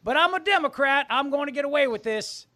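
high, loud Southern politician gloat; hammy Foghorn Leghorn boasting; Southern accent. Pretend. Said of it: "It's my Southern accent".

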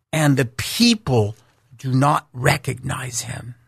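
normal talking voice

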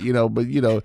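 ordinary talk

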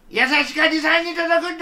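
lightly voiced jizo chant; jizo voices. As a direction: sing it out like the statues. Jizo statues call out.

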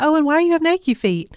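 playful parent. Retold or imitated. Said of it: "we'll be like".

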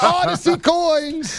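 high, stretched-out mock holler; mock club hype. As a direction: holler it like raining money on stage. Playful strip-club cheer, coins flying.

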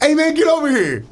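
bright casual come-over; friendly invite. Shown as a demonstration. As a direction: make it a buddy calling you over. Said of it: "it could be like".

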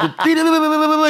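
high-pitched babbled imitation of sped-up speech; high mock fast-forward gibberish; sped-up audio noise. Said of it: "velocidade dois".

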